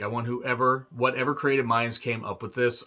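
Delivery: ordinary talk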